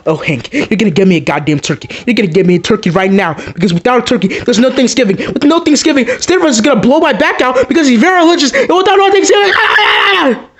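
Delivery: panicked whining that ends in a yell; frantic plea building to a shout. Acted. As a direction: beg harder and harder until you break into a yell.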